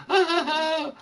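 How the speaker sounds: mocking laugh. Mocking the gossips.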